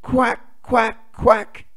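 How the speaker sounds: high, loud little duckling quacks. Acted. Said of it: "says the ducklings".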